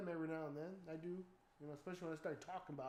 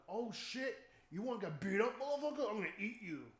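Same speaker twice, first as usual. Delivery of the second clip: louder, higher tough-talk taunt; mock threat; 5 semitones higher, 5 dB louder than usual. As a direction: threaten it half joking. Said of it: "'cause I'm like".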